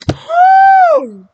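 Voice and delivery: high, loud whoop of laughter; excited whoop. Real, not acted. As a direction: whoop it out, overcome. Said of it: "I need to calm down".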